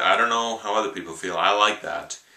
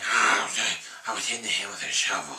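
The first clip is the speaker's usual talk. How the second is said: softer, odd put-on character voice; Little Nicky voice; 4 dB quieter than usual. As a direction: mumble it like a timid weird sidekick. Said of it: "there's a lot of times when he's putting on a voice".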